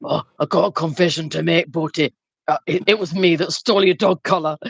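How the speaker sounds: low, sheepish Yorkshire confession; lightly voiced Yorkshire caller, pitched low; Yorkshire caller confessing. Caller confessing to Bertie he stole the dog collar, low Yorkshire voice. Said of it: "he rang in and he's like".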